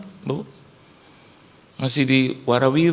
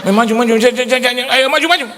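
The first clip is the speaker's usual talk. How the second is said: very high, louder stuttering summons; mock impatient shout; 11 semitones higher, 6 dB louder than usual. Rough preacher hurrying someone forward, mock impatient shouting. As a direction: hurry them up in a comic holler.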